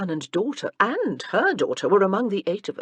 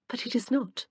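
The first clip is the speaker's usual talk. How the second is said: light, playful retort, a touch higher; teasing; 7 semitones higher, 4 dB quieter than usual. A narrator's reading. Wife teases husband about his family duties, playfully. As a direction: tease him gently with the line. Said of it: "Her eyes twinkled at him".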